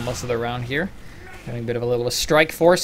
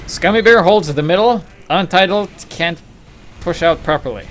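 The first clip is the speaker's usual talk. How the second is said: emphatic caster; 6 semitones higher, 8 dB louder than usual. Real, not acted.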